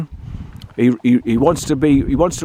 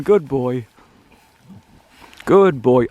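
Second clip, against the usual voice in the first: ordinary talk, nothing marked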